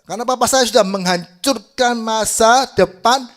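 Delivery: high, loud outburst, hammering the words; dramatized angry shouting; acted furious accusation. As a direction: hurl the blame at full voice. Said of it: "Dia marah-marah".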